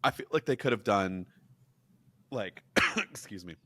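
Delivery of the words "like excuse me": coughing, choking mid-sentence; sputtering through a cough; choking on a drink. Real, not acted. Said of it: "I'm choking over here".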